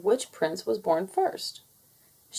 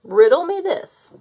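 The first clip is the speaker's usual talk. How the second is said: higher, louder teasing riddle voice; riddling nursemaid; 10 semitones higher, 7 dB louder than usual. Acted. Nursemaid teases the chicks with a riddle, higher and louder. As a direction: pose the riddle with playful relish.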